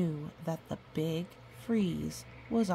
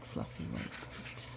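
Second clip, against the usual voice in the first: whisper; 10 dB quieter than usual. Acted. Showing the snow picture to a child, whispered. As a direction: breathe it out in a hush.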